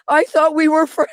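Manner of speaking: high, pitiful retold plea; wounded, high-voiced self-imitation. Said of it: "it sounded so pitiful".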